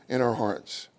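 ordinary talk

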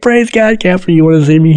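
high, bright burst of praise; joyful greeting. Retold or imitated. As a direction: burst in overjoyed, pitch way up. Bursting happily into captain's office.

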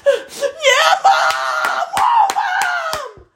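high cheering shout; calling mom for help. Retold or imitated. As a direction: shout it like a fan in the stands.

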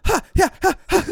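high, loud sword-fighting yells; mock battle cries. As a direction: yell like you're slashing with a katana. Swinging the katana, battle cries.

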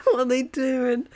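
high, wailing disbelief with a laugh; mock tearful disbelief. Film fan reliving a death scene, mock distraught. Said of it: "I started crying because I thought".

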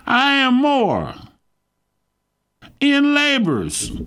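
high, slow, measured scripture declamation; scripture reading. Real, not acted. Preacher reads Paul's sufferings to his congregation, scripture reading. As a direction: read it out slowly, pitched well up.

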